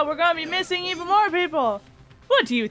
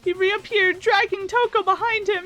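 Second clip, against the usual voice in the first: ordinary talk, nothing marked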